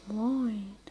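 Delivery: soft unvoiced whisper. Real, not acted. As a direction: whisper so nobody hears you. Hiding in bush, whispered greeting.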